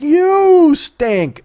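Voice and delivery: ordinary talk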